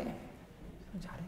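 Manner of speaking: whispered, very slow reassurance. Retold or imitated. Dad whispering to his scared son, slow and hushed. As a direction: breathe it out, drawn out and hushed.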